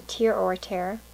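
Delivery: first Tear as TEER, second Tear as TAIR; both readings of tear. Shown as a demonstration. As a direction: Tear rhyming with "ear", then Tear rhyming with "air". Offers both readings side by side.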